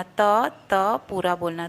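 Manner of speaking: usual speaking voice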